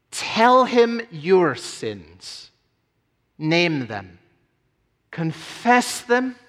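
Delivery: earnest, slow preacher's call; preaching appeal. Acted. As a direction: urge the listeners to come, slowly.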